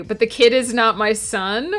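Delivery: ordinary talk, nothing marked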